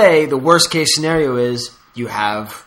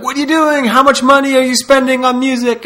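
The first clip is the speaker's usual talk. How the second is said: put-on higher, exasperated boyfriend; 10 semitones higher, 4 dB louder than usual. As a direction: demand it like an annoyed boyfriend.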